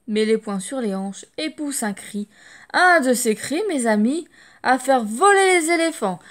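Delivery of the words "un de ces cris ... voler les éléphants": excited narration. A narrator's reading. Narrator excitedly describing cry.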